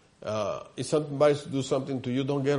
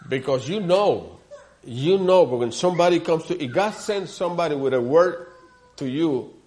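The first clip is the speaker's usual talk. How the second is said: building sermon; 3 semitones higher, 4 dB louder than usual. Acted. Pastor warning the church about God's messenger, preaching hard. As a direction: lift the congregation with it.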